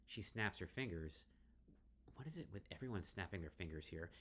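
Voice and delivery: ordinary talk, nothing marked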